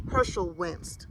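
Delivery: ordinary delivery, nothing marked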